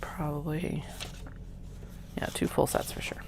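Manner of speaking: whispered to herself; whispering. Real, not acted. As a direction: whisper it under the breath. Whispers while counting.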